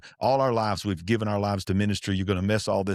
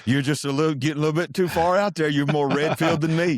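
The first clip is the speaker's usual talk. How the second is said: higher, louder mock wife voice; teasing wife; 7 semitones higher, 3 dB louder than usual. Wife teasing husband about going too far, mock higher voice. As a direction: playfully rein him in, pitched up. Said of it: "she goes".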